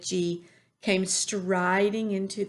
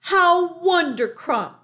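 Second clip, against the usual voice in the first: slow, high, loud delight; beaming cry; 10 semitones higher, 8 dB louder, slower than usual. A narrator's reading. Giant overjoyed that Sophie loves his talk. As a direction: beam and savour it. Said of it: "cried the B F G, still beaming".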